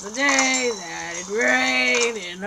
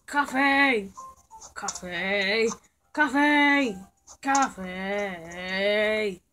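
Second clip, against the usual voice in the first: excited cheer; 5 dB louder, much slower than usual. Real, not acted. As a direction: cheer like you just won. Celebrating getting coffee.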